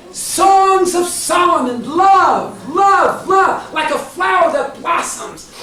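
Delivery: loud, high, drawn-out opening words; ringing pulpit delivery; impassioned preaching. Real, not acted. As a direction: proclaim love like a rousing preacher. Preaches at full force.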